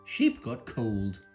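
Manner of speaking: usual speaking voice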